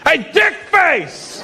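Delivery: slow, high mock holler; drawn-out, high imitated heckle; calling out insultingly. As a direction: holler the insult at someone's back. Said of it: "behind me I hear".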